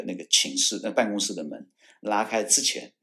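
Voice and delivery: ordinary delivery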